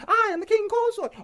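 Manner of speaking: hammed-up high squeaky king consort; mock pompous prince. Mocking Daemon's boast.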